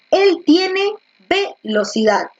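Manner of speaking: "él" stressed, drawn out slowly; teacher stresses "él" in "él tiene velocidad". Shown as a demonstration. Teacher demos a wrong stress, slow and deliberate. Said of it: "con cuál de los cuatro modelos se acentuaba mejor".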